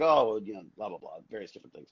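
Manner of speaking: low, fading throwaway mumble; trailing off low and quick; dismissive skim. Retold or imitated. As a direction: gloss over the details, dropping very quiet. Skimming over his own past answer.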